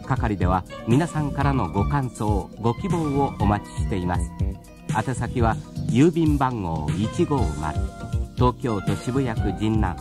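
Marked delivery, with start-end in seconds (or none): none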